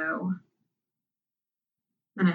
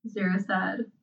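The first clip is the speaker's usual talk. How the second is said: ordinary delivery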